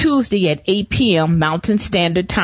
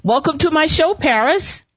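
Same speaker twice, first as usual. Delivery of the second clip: beaming proud parent; 8 semitones higher than usual. Real, not acted. Proud mom welcomes her son.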